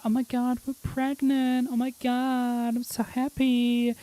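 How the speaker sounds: put-on high, airy valley girl gush. Imitating the valley girl voice actor gushing. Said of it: "acting as a valley girl".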